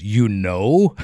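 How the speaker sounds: mock-incredulous question, rising at the end. Trooper to the man he is arresting, incredulous.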